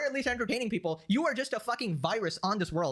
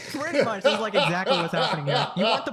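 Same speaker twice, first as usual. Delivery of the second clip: talked through laughter; laughing; 6 dB louder than usual. Real, not acted. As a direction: laugh while agreeing. Laughing at meme.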